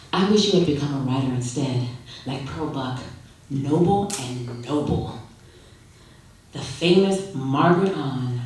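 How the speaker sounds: usual speaking voice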